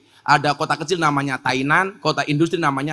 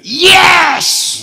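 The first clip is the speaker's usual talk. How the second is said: YES shouted long, high and loud; mock-shout a long, high yes; drawn-out mock shout; 11 semitones higher, 8 dB louder than usual. Preacher mimics the convert's answer, drawn-out mock shout.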